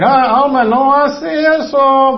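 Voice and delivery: high, loud, drawn-out mock reprimand; God scolding. God correcting Alma inside her heart, high drawn-out scolding. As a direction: stretch out a playful telling-off. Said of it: "Dios hablando".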